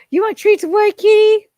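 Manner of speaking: high, loud treat offer; excited pet-talk. Real, not acted. Streamer tempts the cat with treats, high and loud.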